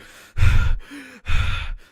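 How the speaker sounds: loud fed-up sigh; loud frustrated sigh. Retold or imitated. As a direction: blow out the frustration loudly. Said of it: "And I would be pissed".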